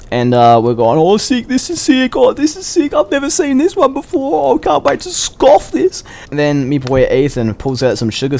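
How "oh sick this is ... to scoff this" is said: very high, gushing over the lollies; excited kids. Retold or imitated. Kids digging through Halloween lollies, very high and excited. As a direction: squeal with delight at each treat.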